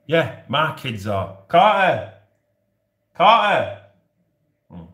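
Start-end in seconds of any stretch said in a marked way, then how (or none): 1.5-2.1 loud call across the house; loud shout for Carter. Retold or imitated. Calling his kids loudly. 3.1-3.8 loud call across the house; loud shout for Carter. Retold or imitated. Calling his kids loudly.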